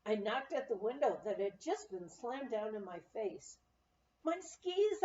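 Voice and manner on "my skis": high, loud cry of alarm; shouting. A narrator's reading. Woman shouting at the clerk about her missing skis. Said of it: "I shouted at him".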